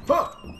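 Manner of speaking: short, high, loud yelp; startled yelp. Real, not acted. Player spooked by what was only dust, startled yelp. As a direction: jump and cry out sharply.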